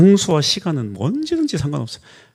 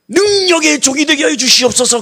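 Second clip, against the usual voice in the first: much higher, sustained pompous prayer voice; grand mock prayer; 13 semitones higher, 6 dB louder than usual. Pastor parodying his own lofty prayer, high and ornate. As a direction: intone it like an overblown prayer.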